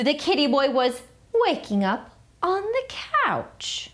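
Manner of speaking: usual speaking voice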